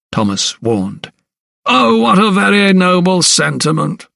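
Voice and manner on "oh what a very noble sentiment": higher, mocking sneer with a lift on Oh. Sir Montague mocking the doctor's warning, sneering. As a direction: pour scorn on his noble words. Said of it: "sneered Sir Montague".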